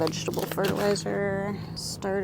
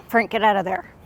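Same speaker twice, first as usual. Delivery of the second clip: raised voice, scolding the cat; calling out; 2 semitones higher, 8 dB louder than usual. Real, not acted. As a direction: call out sharply to a pet. Calling to pet in garden.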